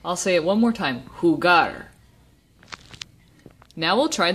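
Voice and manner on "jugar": jugar, landing firmly on GAR; stress on last syllable. Shown as a demonstration. Teacher saying it one more time, last syllable of jugar stressed. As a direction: stress the end of jugar for a learner. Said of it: "the emphasis is on the last syllable".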